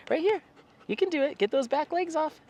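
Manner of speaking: very high, sing-song urging to a pet; coaxing dog talk. Real, not acted. Owner urging the dog to move back legs, high coaxing. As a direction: egg the dog on in a high little voice.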